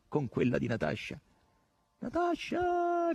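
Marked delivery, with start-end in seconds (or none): 2.0-3.1 high, drawn-out call of a name; voce acuta shout. A narrator's reading. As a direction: call out shrilly, stretching the word. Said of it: "gridò Margherita con voce acuta". (grido acuto e prolungato)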